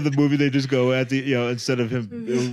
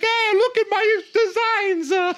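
very high, drawn-out hammy Italian character; exaggerated falsetto impression; Paolo Gucci; slower than usual. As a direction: squeal it slowly like a preening buffoon. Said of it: "he walks in, he's like".

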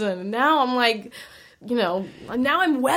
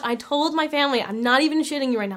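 ordinary talk

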